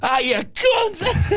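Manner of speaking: high, slow mock yell of frustration; a drawn-out high curse at a lost hand; angry outburst. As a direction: hurl it at the cards in a long, high cry. Cursing a losing hand.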